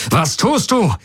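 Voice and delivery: high-pitched, indignant cry; agitated outburst. A narrator's reading. Jun challenging the pilot mid-attack, agitated. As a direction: demand it, voice leaping up in alarm. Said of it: "fragte Jun aufgebracht".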